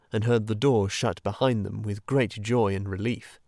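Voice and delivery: plain reading voice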